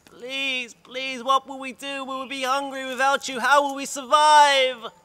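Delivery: high, loud pleading, slowing at the end; girl pleading with the vanished sky, high and loud; despairing plea. Acted. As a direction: beg in despair.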